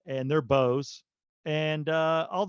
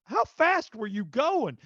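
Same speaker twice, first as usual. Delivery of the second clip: exasperated disbelief; 8 semitones higher, slower than usual. Retold or imitated. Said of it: "I'm like".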